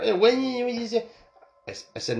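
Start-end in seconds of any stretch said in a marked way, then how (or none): 0.0-1.1 much higher put-on whiny voice; mock line-cutter. Line-cutters protesting to the speaker, in a high mocking voice. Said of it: "then they're".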